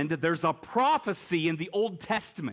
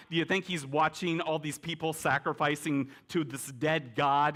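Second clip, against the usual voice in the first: normal talking voice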